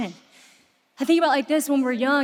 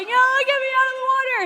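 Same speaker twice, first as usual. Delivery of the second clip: put-on high, fast, frightened little girl's cry; panicked child; 11 semitones higher, 3 dB louder, much faster than usual. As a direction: rush it out like a scared child. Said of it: "she's like".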